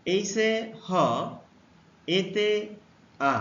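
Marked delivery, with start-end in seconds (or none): none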